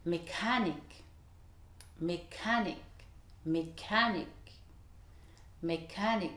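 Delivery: "Mécanique" said with stress on the syllable before "ic"; English stress on CA. Shown as a demonstration. Teacher models "mechanic" stress for students, careful repetition. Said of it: "être sur le ma".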